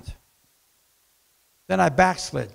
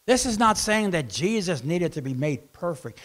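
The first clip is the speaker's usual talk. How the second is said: normal talking voice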